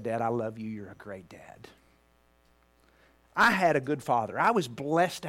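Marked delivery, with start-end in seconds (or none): 0.0-1.7 lower, much softer, fading into a whisper; emotional whisper. Real, not acted. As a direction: let the words barely come out, moved. Son answering father's embrace.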